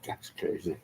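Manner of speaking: breathy throwaway whisper; a hushed, dismissive "that's crazy"; mock whisper. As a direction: brush it off under your breath. Laughing off a pundit.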